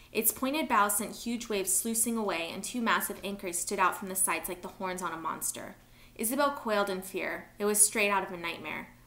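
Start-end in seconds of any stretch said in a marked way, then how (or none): none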